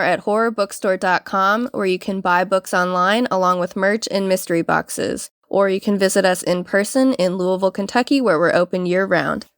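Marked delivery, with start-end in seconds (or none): none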